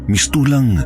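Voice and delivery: ordinary delivery, nothing marked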